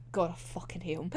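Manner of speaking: normal talking voice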